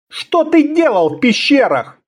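high, menacing interrogation; threatening. A narrator's reading. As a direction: demand it with a threat. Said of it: "с угрозой произнёс".

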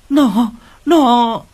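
giọng cao, to, đứt quãng; cố nói mà không thành lời; nghẹn lời. A narrator's reading. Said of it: "Chỉ nói được đến đây". (bà cụ hoảng loạn trước khi ngất, giọng nghẹn)

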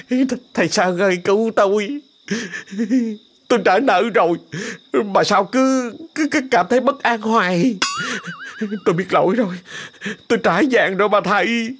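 high, stammering terrified begging; trembling plea. Acted. Sáu Nổ begs the master for help, high terrified. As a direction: beg in panic, stumbling on words. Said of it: "Ông run cầm cập".

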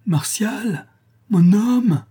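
high, full-force call; hoarse ringing cry. A narrator's reading. La Louve calling her lover at the shut house, ringing cry. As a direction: cry out his name with all your strength. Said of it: "poussa un cri rauque retentissant et se mit à appeler".

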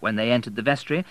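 ordinary delivery, nothing marked

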